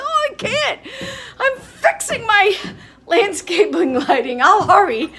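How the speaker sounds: high, loud, laughing apology; retold reply. Sue telling the boat people she's busy, high laughing reply.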